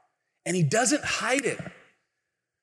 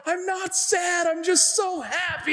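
high, drawn-out tearful wail; hammed-up happy sobbing; mock crying; 12 semitones higher than usual. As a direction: imitate a big guy blubbering. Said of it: "totally crying".

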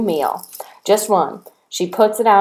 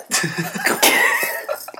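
high squealing laugh; 6 dB quieter than usual. Real, not acted. Big laugh at jitterbug.

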